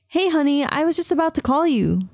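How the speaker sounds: bright, lifted sister's greeting; cheerful. A narrator's reading. Sister answering the phone, cheerfully. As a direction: sound glad to hear her. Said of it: "she said cheerfully".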